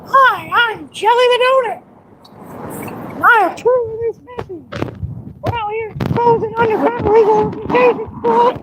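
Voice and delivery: very high squeaky puppet voice; talking donut. Pretend. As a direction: speak as a tiny donut puppet. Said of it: "have the donut talk to y'all".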